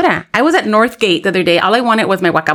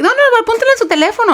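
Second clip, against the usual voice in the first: high, fast imitation of a pushy lady; mock urgent insistence; insistent stranger; 14 semitones higher, faster than usual. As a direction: insist quickly in a pitched-up voice. Pushy stranger voiced insisting on number.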